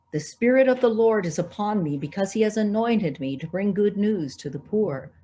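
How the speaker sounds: normal talking voice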